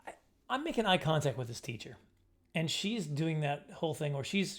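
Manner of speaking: usual speaking voice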